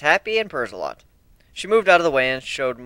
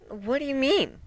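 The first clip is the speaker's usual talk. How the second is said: put-on higher, halting and timid young griffin; meek; 12 semitones higher, 4 dB quieter than usual. Young griffin confessing to ponies, meek and stammering. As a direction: stammer it out, scared to be judged. Maria asks Applejack.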